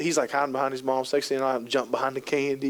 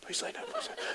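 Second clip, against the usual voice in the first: urgent whispered plea; whispering to the partner to stay in bed; 17 dB quieter, faster than usual. Retold or imitated. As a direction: whisper so the baby won't hear. Urging partner in the night.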